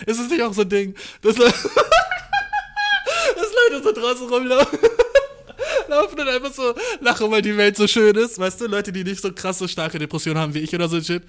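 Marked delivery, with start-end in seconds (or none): 0.1-8.4 high, loud, words broken by laughter; laughing fit. Real, not acted. Mocking happy people, he can't stop laughing. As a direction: laugh so hard the words squeak.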